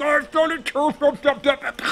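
mocking taunt. A narrator's reading. Jack gloats mid-bite to the family, mocking. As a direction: chew the words smugly while taunting. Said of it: "he starts mocking the family".

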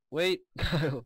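sudden loud high shout; calling out. Real, not acted. Player yells at Kyle to stop him taking blocks, loud sudden call. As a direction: shout to stop your friend, laughing. Calls out to friend mid-game.

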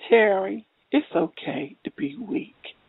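soft, slow, gentle reassurance; sweet, kind voice. Retold or imitated. God's voice comforting an exhausted mother, sweet and kind. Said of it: "it said it so lovely and sweet and kind".

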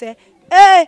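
high, loud "eh!"; loud exclamation. Real, not acted. Host breaking into shout-outs, loud exclamation. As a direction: burst out with a sharp high cry.

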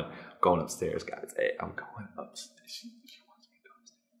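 soft mumble sinking into a whisper; hushed confiding. Acted. Drunk confiding to his guys while leaving, whispered. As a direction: lean in and let it fade to a whisper.

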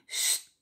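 unvoiced "sst" hiss; short hushing sound; shushing. A narrator's reading. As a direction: hush the children. Father hushing the children.

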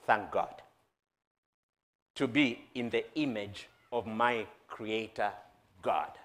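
low, soft preaching with pauses; hushed, low sermon delivery; reverent reflection. Acted. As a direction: speak it softly, in grateful awe.

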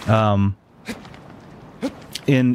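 ordinary talk

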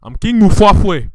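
slow, loud royal bellow; indignant slow shout; shouted proclamation. Pretend. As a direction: boom out the name insistently. King insists on his name.

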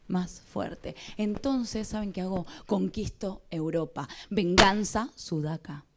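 slow, emphatic stage delivery; deliberate dramatic monologue with punched words; theatrical monologue. Acted. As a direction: declaim it dramatically to an audience. Stage monologue performed to audience.

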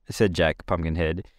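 ordinary delivery, nothing marked

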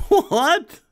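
high, loud rising disbelief with a laugh; incredulous. Real, not acted. Streamer cannot believe the clip's claim, laughing incredulously. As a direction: burst out in amused disbelief, pitch shooting up. Baffled by the played clip.

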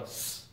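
a lone hissed "s", no vowel; sustained "s" as a sample sound; demonstrated s sound. Shown as a demonstration. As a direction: make just the consonant sound "s". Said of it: "sound for C".

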